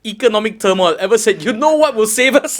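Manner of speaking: high, loud comic rant; loud, high-pitched mock proclamation; mock incredulous. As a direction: belt it out in hammed-up disbelief. Mocking the idea of countries choosing comedy.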